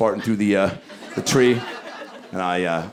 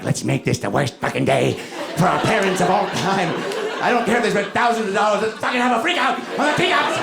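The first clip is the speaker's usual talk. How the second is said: put-on high, bratty kid voice; scheming kids; 7 semitones higher than usual. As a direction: plot mischief like a naughty child. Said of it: "being like".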